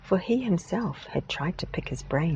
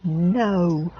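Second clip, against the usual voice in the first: a loud bawled refusal; 2 semitones higher, 6 dB louder than usual. Acted. Great-granddad refusing the American's offer, loud bawl. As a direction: bawl it, enjoying himself. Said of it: "bawled Great-Granddad".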